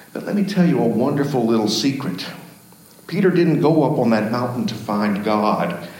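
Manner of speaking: ordinary talk, nothing marked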